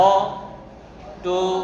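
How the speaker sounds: "Automobile" in four slow separate beats; broken into syllables. Shown as a demonstration. Teacher showing a polysyllable in four pieces, broken into syllables. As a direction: pause after অ, টো and মো. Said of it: "এই যে ভেঙে ভেঙে চারবার হয়ে গেল".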